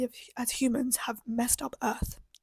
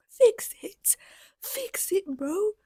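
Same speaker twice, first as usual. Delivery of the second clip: exasperated shout; 7 semitones higher, much slower than usual. Real, not acted. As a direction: yell it in frustration. Exasperated demand.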